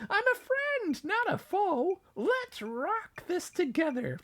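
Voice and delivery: high, friendly squeak; peeping mouse. A narrator's reading. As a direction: reassure him as a tiny mouse. Mouse reassures lion.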